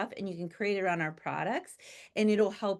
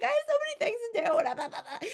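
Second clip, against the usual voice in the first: high laughing whine, much higher; hammed-up overwhelmed protest; whiny excuse through laughter; mock whine. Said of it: "And I was like".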